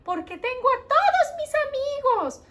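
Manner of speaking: high, bouncy puppet voice; lightly voiced cheerful heart; happy heart puppet. Heart puppet tells the teacher why it is glad, high and bright.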